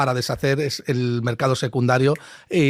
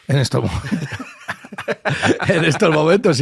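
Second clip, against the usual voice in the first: higher, words broken up by laughter; laughing; 4 semitones higher, 3 dB louder than usual. Real, not acted. Guest dismissing fixed income to his host, laughing. Laughs at own blunt remark.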